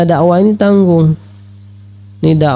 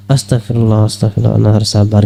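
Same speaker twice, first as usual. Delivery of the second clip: muttered self-calming; 7 semitones lower, faster than usual. Retold or imitated. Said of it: "nyebut sama Allah".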